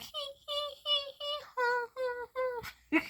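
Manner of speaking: high, mocking hee-hee hoo-hoo laugh; mock high cackle. Haters plotting against the blessed guy, mock high cackle. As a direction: cackle like a sneaky backstabber. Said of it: "They'll just be like".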